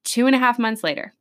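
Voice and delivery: normal talking voice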